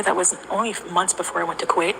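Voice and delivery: ordinary delivery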